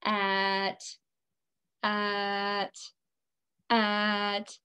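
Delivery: monotone robot on one level pitch; level, mechanical drone. Shown as a demonstration. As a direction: say it like a machine, even and unbending. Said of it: "say it in our robot voice".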